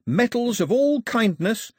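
high, strong, drawn-out list item; eager islander. A narrator's reading. Pilou listing gifts for the king, eager. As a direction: pitch it high and keen.

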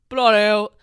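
loud exasperated bloody hell; loud exclamation. Real, not acted. Disgusted at herself, loud exclamation. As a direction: blurt it out loudly.

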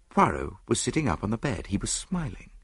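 ordinary delivery, nothing marked

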